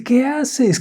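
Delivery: high, startled shout; alarmed mother. A narrator's reading. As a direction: catch the child in danger. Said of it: "Corre y le dice".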